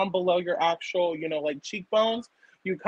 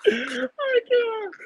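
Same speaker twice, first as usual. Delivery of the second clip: laughing. Real, not acted. Crying with laughter.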